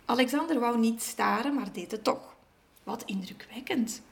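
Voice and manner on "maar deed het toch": plain reading voice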